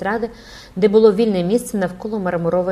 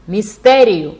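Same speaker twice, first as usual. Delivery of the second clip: loud, insistent one-word cry; crowd shouting; 2 semitones higher, 9 dB louder, slower than usual. A narrator's reading. The crowd repeats its demand for the mystery, shouting. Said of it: "кричала юрба".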